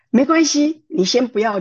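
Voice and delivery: mother-in-law eagerly giving way; eager reassurance. Retold or imitated. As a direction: rush to reassure her.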